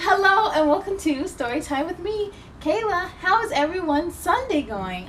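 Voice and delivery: put-on cheerful kids' show opener; bright host welcome.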